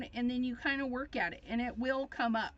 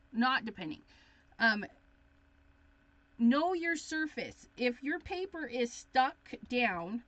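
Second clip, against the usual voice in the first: ordinary delivery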